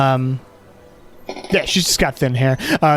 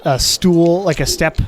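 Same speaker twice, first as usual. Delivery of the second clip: ordinary talk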